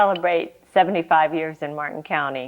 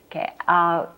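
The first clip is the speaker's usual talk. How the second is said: ordinary talk, nothing marked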